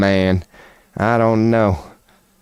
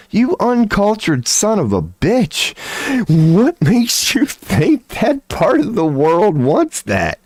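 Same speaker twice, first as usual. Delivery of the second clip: exasperated rant; 9 semitones higher, slower than usual. Real, not acted. Ranting at Trump, exasperated.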